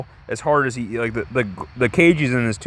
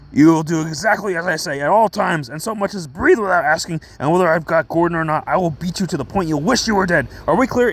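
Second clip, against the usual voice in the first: higher, put-on furious commanding voice; mad Batman; 6 semitones higher than usual. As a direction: lay down the law in mock rage. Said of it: "he's so mad".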